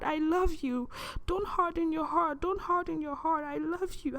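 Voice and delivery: high, urgent pleading, over and over; pained, caring voice. Retold or imitated. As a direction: plead lovingly through the pain, pitched high. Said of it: "was in pain".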